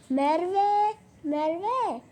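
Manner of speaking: loud call across the room. Acted. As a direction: call her name to get her attention. Calling out to the crush. (sevdiği kıza seslenirken yüksek çağrı)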